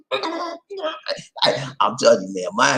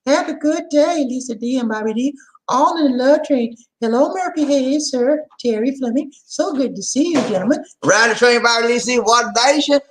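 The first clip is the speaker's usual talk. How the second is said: high, light imitation of a fan's greeting; Sincerely voice; 10 semitones higher than usual. Reading a fan's greeting in her high Sincerely voice. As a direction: voice the fan sweetly, way up high. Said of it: "my Sincerely voice".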